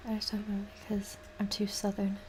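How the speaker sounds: soft whispered aside. Real, not acted. Streamer musing to herself about her accent, whispering. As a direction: drop to a voiceless whisper, half to yourself.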